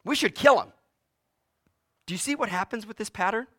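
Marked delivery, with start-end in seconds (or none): 0.0-0.7 fast, then loud and high; callous verdict. Retold or imitated. David judging from a distance, eager and loud. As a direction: rush to condemn with zeal. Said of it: "from a distance he says".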